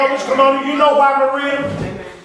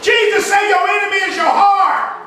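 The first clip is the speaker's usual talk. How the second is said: full-force preaching; 5 semitones higher than usual. Acted. Preacher chanting at the congregation, full force. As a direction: belt it to the congregation.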